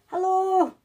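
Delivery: high, loud imitation of a small voice; wee voice. Medium imitating a dead dad's voice on tape, high wee voice. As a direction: call out as an eerie little voice. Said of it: "this wee voice came and went".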